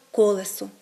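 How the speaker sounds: «колесо» stressed on the first syllable; нормативний наголос. Shown as a demonstration. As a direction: say «колесо» as KOleso. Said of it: "за нормами української мови маємо говорити". («колесо» з наголосом на першому складі)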